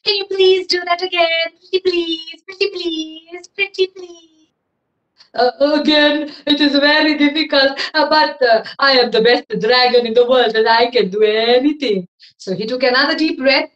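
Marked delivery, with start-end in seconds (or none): 0.0-4.5 high, pleading princess voice; cartoon princess. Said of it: "said the princess". 5.3-12.1 loud, proud dragon voice; boastful dragon. Acted.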